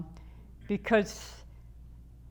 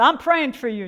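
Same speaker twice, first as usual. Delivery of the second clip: loud, higher mock of Lena May; imitating her loud, higher and gleeful; mock preacher shout; 6 semitones higher, 10 dB louder than usual. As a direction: belt it like a sassy church lady. Coworker's preaching retort.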